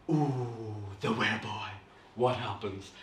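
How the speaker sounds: low, soft gasping suspense; hushed, mock-spooky teaser; spooky intrigue. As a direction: build mystery around the story title. Teasing a spooky story title.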